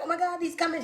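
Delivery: high-pitched mock crush panic; acting out a flustered crush; giddy nervous excitement. Younger self spotting her crush, giddy. You gotta not be nervous.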